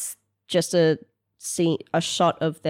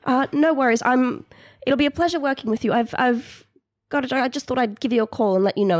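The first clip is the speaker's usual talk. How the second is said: higher, halting, eager-to-please voice; polite new employee, pitched up; nervous phone manner; 6 semitones higher, 5 dB louder than usual. Acted. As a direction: stumble politely through a call to the boss.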